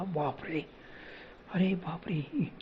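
breathless whispered gasp; frightened gasp. Acted. Narrator recoils at the ghost voice, whispered gasp. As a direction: gasp it in terror. Karan nearly dragged under.

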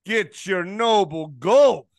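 slow, loud ad pitch; ad read. Acted. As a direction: sell it like a commercial. Host reads sponsor ad.

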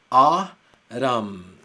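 A, ram split into two loud syllables; syllable-split Autumn. Shown as a demonstration. Teacher spelling out how Autumn should be written by sound. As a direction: break it into A and ram. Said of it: "Se debería de escribir A, ram".

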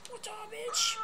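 shouted high, cocky hello; taunting shout. Acted. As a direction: yell it at the screen like a taunt. Taunting in game.

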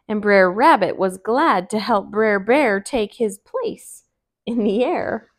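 ordinary delivery, nothing marked

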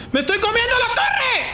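high panicked yell; alarmed yell. Real, not acted. Player yelling to his team while taking tower damage, high panicked shout. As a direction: shriek it out in alarm.

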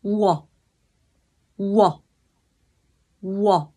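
slow drilled ua, each syllable held apart; gliding u into o as one sound; Korean vowel ua. Shown as a demonstration. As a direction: model ua for learners. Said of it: "Đọc nhanh chữ u với chữ o nó sẽ ra là".

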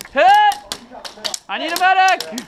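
man down shout. Real, not acted. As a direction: yell for help from the ground. Downed player calling medic.

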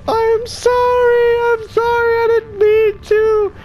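put-on high, slow, slurred drunk lady apologizing. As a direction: whine it out like a tipsy woman saying sorry.

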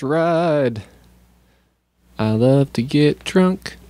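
slow, drawn-out commercial voice; mock ad read. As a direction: read it like a cheesy radio ad.

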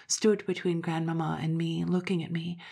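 usual speaking voice

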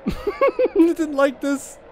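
high, slow, laughing whimper; nervous laughing. Real, not acted. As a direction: squeal it through nervous laughter. Laughs nervously while being attacked.

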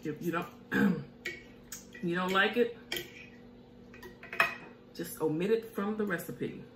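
normal talking voice

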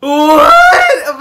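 loud startled outcry; shocked cry. Real, not acted. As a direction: yelp it in disbelief. Shocked by the teaser.

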